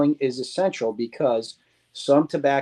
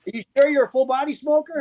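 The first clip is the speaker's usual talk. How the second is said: much higher, fast teasing question; mocking challenge; 11 semitones higher, faster than usual. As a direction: needle him about his claim, quickly. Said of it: "so it's like".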